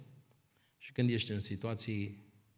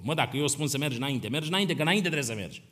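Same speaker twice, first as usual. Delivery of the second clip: scolding father crab; 5 semitones higher, 6 dB louder, faster than usual. Acted.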